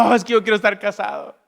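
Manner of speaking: high, loud insistent wish; mock earnest longing; pleading single. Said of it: "los solteros dicen".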